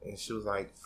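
normal talking voice

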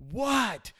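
high, exasperated mock yell; exasperated parent. Dad giving in and going back in, exasperated. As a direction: snap it as a worn-down parent. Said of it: "I'm like".

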